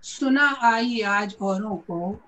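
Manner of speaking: slow, measured verse recital; ghazal recitation. Acted. Poet reciting to a mushaira audience, measured recitation. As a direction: recite the couplet slowly, with pauses between phrases.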